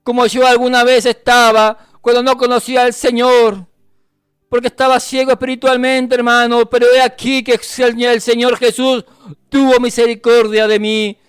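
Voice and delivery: sustained, insistent preaching delivery; fervent preaching. Real, not acted. Preacher exhorting the brethren to keep crying out, fervent. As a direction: preach it urgently to the congregation.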